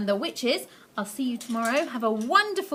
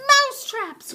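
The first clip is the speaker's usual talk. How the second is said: much higher, louder witch cry; excited cry; 13 semitones higher, 8 dB louder than usual. Acted. Witches echo their leader, excited cry. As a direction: shriek back gleefully. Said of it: "cried the witches".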